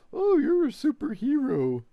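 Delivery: high, gushing mock praise. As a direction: gush like a patronizing fan. Mocking how media talks.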